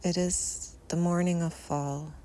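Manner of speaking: normal talking voice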